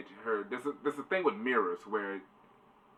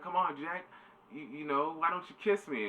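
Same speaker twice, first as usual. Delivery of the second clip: high, mock-flirty pleading; coaxing Lucy; 8 semitones higher than usual. Lucy asking Jack for a kiss, high mock coaxing. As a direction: coax in a put-on high voice.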